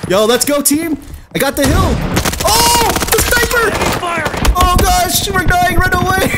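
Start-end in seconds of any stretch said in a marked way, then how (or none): 2.4-6.3 high-pitched gamer panic; high, alarmed exclamations; panicked cries. Real, not acted. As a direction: yell for everyone to flee. Fleeing a sniper in game.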